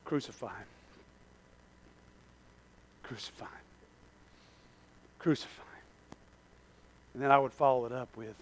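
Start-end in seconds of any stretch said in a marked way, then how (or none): 0.0-6.2 very soft, low, sinking to a whisper; quiet, near-whispered plea; hushed confession. Acted. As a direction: say it barely aloud, with long pauses. Confesses secret answer, spaced out.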